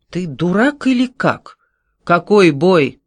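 exasperated scolding. A narrator's reading. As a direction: scold him as if he's an idiot. Senior guard scolds recruit.

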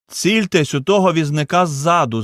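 high, loud command; urgent order. A narrator's reading. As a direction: shout instructions over the chaos. Brother urgently instructs in chaos.

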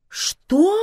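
high, loud incredulous question; utterly amazed. A narrator's reading. Vivian hears they were only talking, exclaims in amazement. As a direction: let the one word soar in disbelief. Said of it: "Удивлению Вивиан не было предела".

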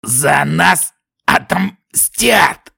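slow, strained vow of revenge; dying threat. A narrator's reading. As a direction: rasp a last defiant threat.

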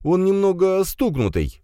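high-pitched, flustered aside; flustered mutter. A narrator's reading. Firs explains strange guest to father, flustered. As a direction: explain him away, at a loss. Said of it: "растерянно пробормотал Фирс".

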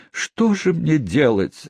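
high, slow tearful plea; tearful cry. A narrator's reading. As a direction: wail it, close to tears. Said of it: "заливаясь слезами, воскликнула". (сквозь слёзы)

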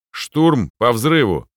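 loud, slow, firm order; slow loud command. A narrator's reading. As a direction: call out the order slowly and firmly. Lieutenant orders assault before battle.

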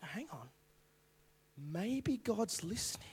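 very soft, slow dawning wonder; hushed realisation. Acted. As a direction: let the thought creep in almost whispered.

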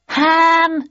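high, loud heckle; heckler's yell. A narrator's reading. Someone at the back heckling the actor, yelling. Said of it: "yelled someone at the back".

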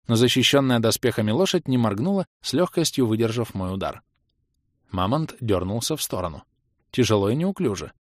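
usual speaking voice

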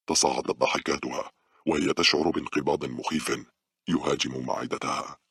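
ordinary delivery, nothing marked

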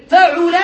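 فعل said fa-'u-la, middle vowel u; damma on ain. Shown as a demonstration. Teacher listing verb patterns, فعل as fa'ula. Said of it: "بضم العين".

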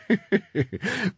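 high smoky dragon chuckle; smoky dragon breath. Acted. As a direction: laugh through a puff of smoke. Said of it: "выпустила клуб дыма из ноздрей и засмеялась". (дымный смех драконицы)

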